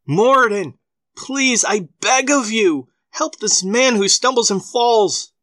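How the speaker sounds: high, pleading cry to a god. Acted. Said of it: "begged him to help".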